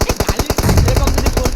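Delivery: loud outburst. Real, not acted. Heated banter over insults mid-game.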